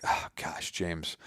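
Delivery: breathy, soft groan of a name; exasperated whisper. Retold or imitated. Dad fed up with his son, whispered sigh.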